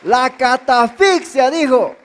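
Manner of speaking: a teacher correcting, drawn out; slow emphatic correction. Acted. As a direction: spell out "catafixia" syllable by syllable.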